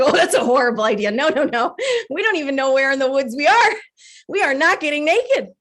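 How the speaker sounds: emphatic, half-laughing protest; indignant refusal. Acted. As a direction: argue back, rising as you refuse. Acted argument with inner voice.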